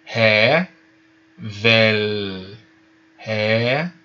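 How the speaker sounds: drawn-out "Ré" with breathy h, pause, long "vel"; aspirated syllables. Shown as a demonstration. Teacher repeats for pupils, "Ré" aspirated, syllables apart. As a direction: hold "Ré" and "vel" as separate syllables. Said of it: "R levemente inspirado".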